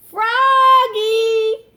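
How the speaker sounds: loud, high, sung-out yell. A narrator's reading. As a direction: call the name out in alarm. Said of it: "yelled his mother and father".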